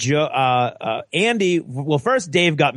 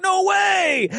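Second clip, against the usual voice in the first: emphatic high refusal. Retold or imitated. Refusing the massage lady, high shouted refusal. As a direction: squeal it out, horrified.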